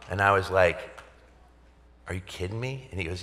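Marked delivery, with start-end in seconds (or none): 2.1-2.9 higher, hushed retold incredulity; soft disbelief. Son hearing dad has prayed, soft disbelief. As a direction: ask softly, pitched up, stunned.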